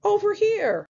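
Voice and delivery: high pig calling out; high-pitched storybook pig; third little pig. Acted. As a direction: call over in a high piglet voice. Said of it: "The third pig says".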